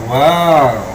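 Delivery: big, loud mock wow; hammed-up loud wow. Mocking a flat wow. (malakas na pabirong wow)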